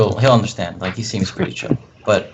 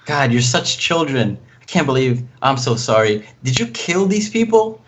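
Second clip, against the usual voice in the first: higher-pitched, flustered scolding and apologizing; worried mother; 4 semitones higher than usual. Acted. Player as worried mother scolding the group, freaking out. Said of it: "freaking out like, um, like a worried mother".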